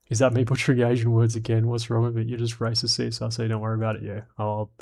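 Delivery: ordinary talk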